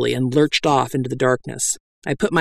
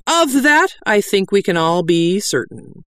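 high emphatic opening, settling into certainty; mustered authority; 6 semitones higher than usual. Acted. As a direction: preach it with authority. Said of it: "with as much authority as I could muster".

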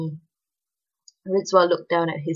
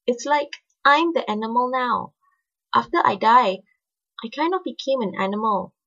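put-on higher, earnest young girl; 6 semitones higher than usual. A narrator's reading. Narrator voices Ali at length.

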